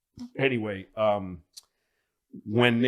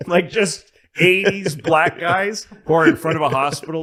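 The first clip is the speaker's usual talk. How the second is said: normal talking voice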